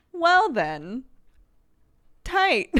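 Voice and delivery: high and loud, a hammed-up cheer; mock-pumped reply. Streamer recalls answering a friend's praise, high, loud mock cheer. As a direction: blurt it like a pumped-up kid.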